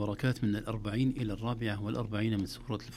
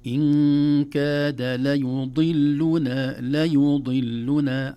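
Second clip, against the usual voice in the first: loud, very slow chanted recitation; Quran recitation; 3 semitones higher, 8 dB louder, much slower than usual. Shown as a demonstration. Teacher modelling a tricky word, loud slow recitation. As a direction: draw out each vowel firmly.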